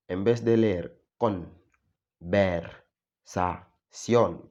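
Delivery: ver, sa, sion split into slow loud syllables. Shown as a demonstration. Teacher sounds out syllables the Spanish way, slowly. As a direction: spell out ver, sa, sion one beat at a time. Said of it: "como sería en español".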